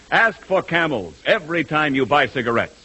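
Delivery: normal talking voice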